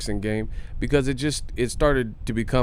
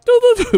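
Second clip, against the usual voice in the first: high burst of laughter; 9 dB louder than usual. Real, not acted. Cracks up at own joke.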